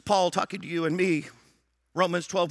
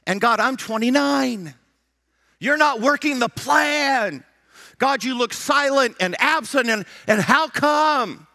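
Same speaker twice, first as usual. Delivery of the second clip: loud, slow, raised preaching voice; pulpit-pounding sermon delivery; fiery preaching; 6 semitones higher, 5 dB louder, slower than usual. Acted. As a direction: preach it hard at the crowd. Preaching at full force.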